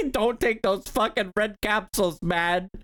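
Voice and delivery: lightly mock the game's stern advice; mock game warning. Quoting the game's warning, mocking.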